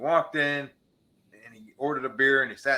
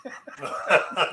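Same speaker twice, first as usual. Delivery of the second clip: short burst of higher laughter; laughing; 4 semitones higher than usual. Real, not acted. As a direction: laugh at the banter. Laughter amid banter.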